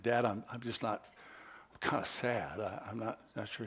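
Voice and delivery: mild retold choked-up voice; choked-back tears. A boy tells his dad on the phone he's sad, mildly choked up. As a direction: hold back tears while telling Dad. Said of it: "I kinda choked back the tears".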